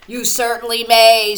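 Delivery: loud and hearty bone; the bone's voice. A narrator's reading. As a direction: boom it out gladly. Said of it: "said the bone".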